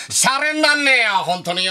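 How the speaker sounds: high-pitched impression of a complaining pitcher; Degawa. Comedian retelling a pitcher hit by a batter, imitating Degawa's complaint. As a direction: pitch it up in mock protest, then ease down. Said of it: "そしたら出川さん言ってましたもん".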